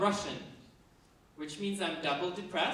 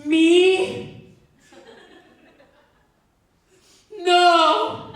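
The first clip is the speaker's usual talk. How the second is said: high, loud outraged squeal; shocked denial; 13 semitones higher, 17 dB louder than usual. Retold or imitated. Hearing the doctors' diagnosis, squealing high and loud. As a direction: shriek it in mock disbelief. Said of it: "I was like".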